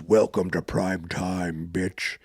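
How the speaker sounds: low, slow movie-villain voice; deep mock villain one-liner; villain catchphrase. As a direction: drop it low and draw out the menace.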